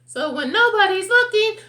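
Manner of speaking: high, loud cartoon bird; shrieky, forceful bird character. A narrator's reading. Bird explains its fear and remedy.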